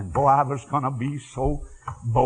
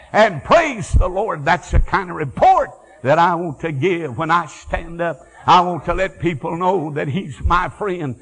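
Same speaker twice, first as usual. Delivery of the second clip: preaching; 2 semitones higher, 4 dB louder than usual. Acted. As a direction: lift the crowd with praise. Preaching at full force.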